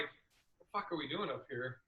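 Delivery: soft, low, bewildered aside; hushed disbelief. Retold or imitated. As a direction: mutter it under your breath, lost on stage. Awkward silent stage moment.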